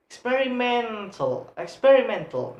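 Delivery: "experimental" with a long, stressed MEN. Shown as a demonstration. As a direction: stretch the second-last syllable, experi-MEN-tal. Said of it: "men have a stress".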